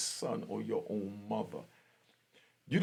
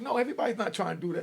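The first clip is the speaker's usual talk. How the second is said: grandma protesting; 9 semitones higher, 4 dB louder, much faster than usual. Retold or imitated. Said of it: "And she's like".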